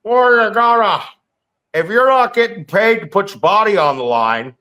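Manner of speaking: mocking whiny excuse. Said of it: "hearing people go that".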